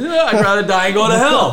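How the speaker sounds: mocking the firm's stance, laughing with cohost; laughing sarcasm. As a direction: scoff it out while cracking up. Sarcastic quote amid laughter.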